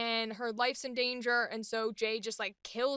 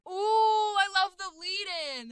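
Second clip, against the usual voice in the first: excited; 8 semitones higher, 4 dB louder, slower than usual. Real, not acted. Reacting to own take.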